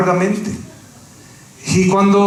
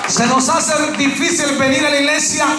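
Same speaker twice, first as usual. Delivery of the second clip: preaching at full force; 4 semitones higher than usual. Acted. Pastor to his church, preaching at full force. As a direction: lift the congregation with a booming shout.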